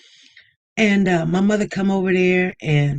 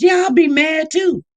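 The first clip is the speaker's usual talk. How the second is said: emphatic; 7 semitones higher, 4 dB louder than usual. Real, not acted. Emphatic agreement, raised.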